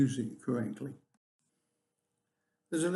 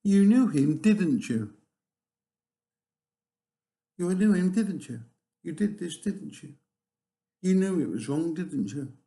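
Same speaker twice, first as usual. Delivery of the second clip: loud, pressing lawyer, tags falling hard; cross-examining lawyer; 4 semitones higher, 5 dB louder than usual. Acted. Lawyer cross-examining someone before a jury, loud and pressing. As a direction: hammer the witness, give no room to answer. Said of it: "with a lawyer ... cross-examining them".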